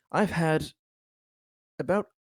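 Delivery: halting, long pauses as if tallying; pretending to count. Official faking a tally of complaints, halting and drawn out. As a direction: stretch it out, feigning a careful count. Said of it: "pretending like he's, uh, counting them".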